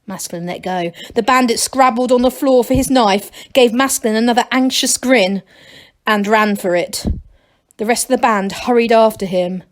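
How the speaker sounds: ordinary delivery, nothing marked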